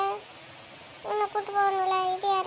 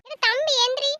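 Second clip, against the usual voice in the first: high, raised wake-up call; urgent calling out; 7 semitones higher, 4 dB louder than usual. Acted. Sister waking brother from outside, high urgent call. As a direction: call up high to rouse him.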